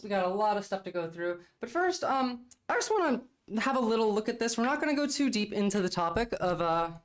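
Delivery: ordinary talk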